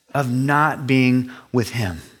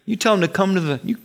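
higher, urgent relaying of orders; lightly voiced anxious Moses; Moses pleading; 6 semitones higher than usual. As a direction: sound like a harried Moses passing on rules. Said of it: "Moses cried out to the Lord".

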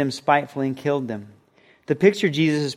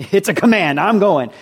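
higher, louder, with a laugh; laughing agreement; 5 semitones higher, 5 dB louder than usual. Acted. Subject to the king's command, laughing and obeying. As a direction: laugh it off and comply.

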